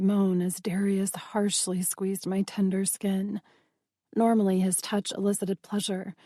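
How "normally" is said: ordinary delivery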